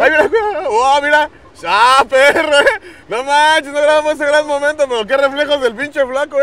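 high, very loud startled shouts; alarmed yelling. Real, not acted. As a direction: yell out as your friend nearly falls. Companion nearly falls, alarmed yelling. (gritos de susto, agudos y fuertes)

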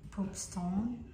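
usual speaking voice

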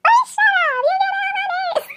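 very high, stretched-out mimicked scolding; squealed mock telling-off; mocking imitation. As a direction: parody her annoyance way up high, dragging out the ending. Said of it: "She said it like that, y'all".

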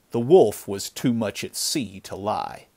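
ordinary delivery

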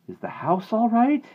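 high, anxious question; shaking voice. A narrator's reading. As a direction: ask it loudly in fright. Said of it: "Sandy's voice shook".

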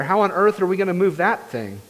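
ordinary delivery

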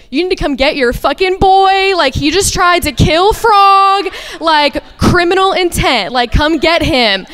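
high-pitched, exasperated demand; retold outraged call. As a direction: relive an urgent, indignant phone call. Urgent call to boyfriend about cat.

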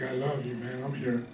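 low, very soft comforting voice; quiet, intimate promise of love; tender reassurance. Acted. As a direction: reassure them gently, almost under your breath. Voices God reassuring the believer.

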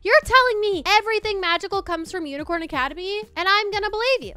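normal talking voice

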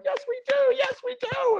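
put-on very high squeaky child voice; imitated kids piping up; eager kids. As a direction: chirp it like overexcited children.

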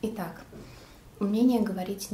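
speaking while chewing cake; talking with food in mouth. Real, not acted. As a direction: mouth partly full of cake. Seen 0: bite taken at -1.0, lips pressed as if chewing while speaking.

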